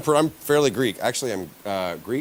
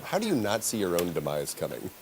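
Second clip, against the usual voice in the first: ordinary talk, nothing marked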